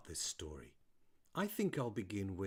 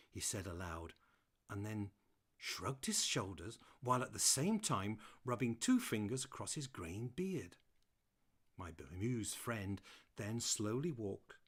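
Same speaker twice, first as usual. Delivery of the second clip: ordinary delivery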